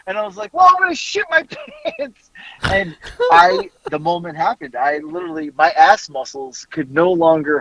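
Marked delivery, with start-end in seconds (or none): 0.5-2.3 high, frantic comeback cracking into laughter; retold desperate yelling. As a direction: wail back in mock desperation. Yelling back while about to burst.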